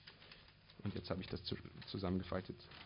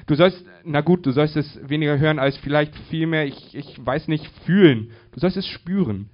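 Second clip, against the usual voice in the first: loud, high, searching for words; agitated, high and emphatic; urgent insistence; 6 semitones higher, 10 dB louder than usual. Acted. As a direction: push it out insistently, punching key words. Woman urgently groping for words.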